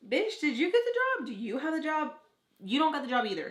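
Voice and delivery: high, loud sassy clapback; loud, high mock-outraged retort; sassy comeback. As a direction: snap it right back at them. Snapping back at nosy people.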